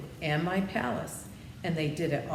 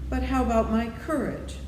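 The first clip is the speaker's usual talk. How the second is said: a touch of worry, higher and louder; timid Lion's anxious plea; 7 semitones higher, 5 dB louder than usual. A narrator's reading. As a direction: ask it nervously, hoping. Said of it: "asked the Lion anxiously".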